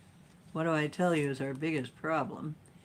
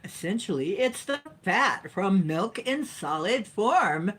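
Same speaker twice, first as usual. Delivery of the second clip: dramatic reading; 4 semitones higher, 5 dB louder than usual. Pretend. Vlogger explains butter to viewers, dramatic reading. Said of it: "I'll read it dramatically".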